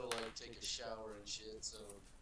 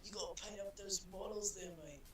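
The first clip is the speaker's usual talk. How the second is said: higher-pitched hammed-up Aussie accent; mock Aussie bloke; 9 semitones higher than usual. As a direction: play a rowdy Aussie mate. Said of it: "Oh, mate".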